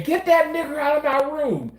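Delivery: high, mock yell of an angry patient; quoting the patient's high-pitched outburst. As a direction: throw the insult at him like the furious patient did. Patient yelling at him, quoted.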